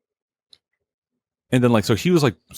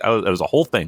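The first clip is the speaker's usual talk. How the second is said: normal talking voice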